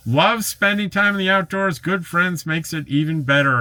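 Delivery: reading the comment high and cheerful; high, cheery comment reading; upbeat read. Retold or imitated. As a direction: read it like a happy buddy.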